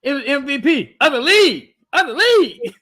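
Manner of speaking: high-pitched, shouted mockery; over-the-top excited yell; loud mocking boast.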